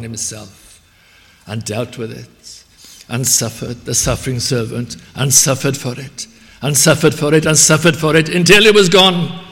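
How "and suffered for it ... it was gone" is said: rising, growing louder each time; building to a shout. Real, not acted. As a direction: climb to a full preaching shout. Preacher building to a climax.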